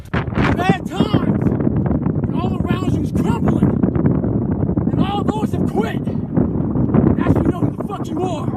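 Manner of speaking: loud, driving, intense delivery; fired-up loud. Real, not acted. To the listener who quits when times are bad, fired-up loud. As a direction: hammer it home, raising the voice. Fired-up peak of motivational speech.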